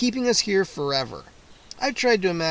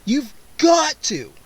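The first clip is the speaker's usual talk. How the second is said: higher, slow pleading insistence; desperate plea; 8 semitones higher, 5 dB louder, slower than usual. A narrator's reading. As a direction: beg with all you have. Narrator pleading.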